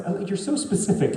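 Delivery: ordinary talk, nothing marked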